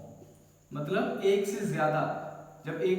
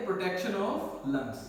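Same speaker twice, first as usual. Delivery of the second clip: slow, word-by-word dictation, a little softer; 2 semitones lower, 5 dB quieter, much slower than usual. Shown as a demonstration. Teacher dictating a note to students, slow and deliberate. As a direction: spell it out slowly so they can write. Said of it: "इतना note करो".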